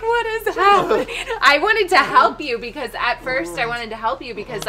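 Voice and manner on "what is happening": high-pitched squeal of disbelief; bewildered, reenacted. Friend watching the prophecy, high bewildered squeak. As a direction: ask it in overwhelmed amazement. Acts out her bewildered reaction.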